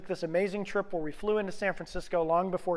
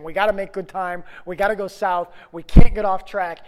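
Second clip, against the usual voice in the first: louder, rising urgent insistence; George Costanza; 12 dB louder than usual. Retold or imitated. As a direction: push it like an anxious planner. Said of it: "I was the George Costanza in the group saying".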